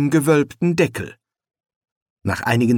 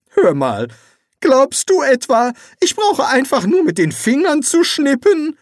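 high, loud indignant voice; exasperated retort; 13 semitones higher, 4 dB louder than usual. A narrator's reading. Rincewind protesting to Eric, high and indignant. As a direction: protest the absurd demand.

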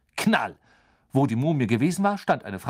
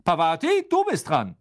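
called out, raised voice; Lupin; 5 semitones higher, 4 dB louder than usual. A narrator's reading. Teacher summoning Pavati to her turn, calling out. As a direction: call the next pupil up briskly. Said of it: "und Professor Lupin rief".

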